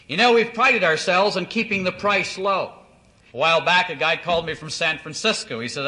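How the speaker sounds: ordinary delivery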